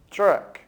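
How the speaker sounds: truck said the English way, for students to repeat. Shown as a demonstration. Teacher models truck for students to repeat, in English pronunciation. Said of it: "Repitam comigo mais uma vez".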